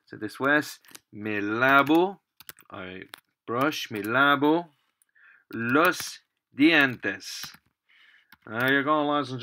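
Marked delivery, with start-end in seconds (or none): none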